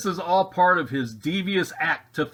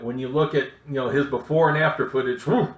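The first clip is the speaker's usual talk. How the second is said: ordinary delivery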